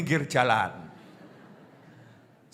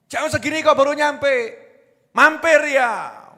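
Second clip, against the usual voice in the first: higher and louder, suspicious nagging wife; wife's accusation; 8 semitones higher, 5 dB louder than usual. Retold or imitated. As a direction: scold the husband for coming home late. Wife interrogates husband. (istri curiga menegur suami dengan keras)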